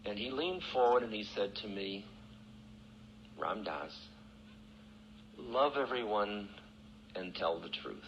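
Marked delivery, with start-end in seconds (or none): 3.4-8.1 tender teaching. Retold or imitated. Guru's soft final teaching.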